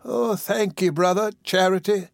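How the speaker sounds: high, weak invalid voice. Acted. Sick woman thanking her visitor, weakly. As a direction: answer as a feeble patient, pitched high. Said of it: "said the weak voice".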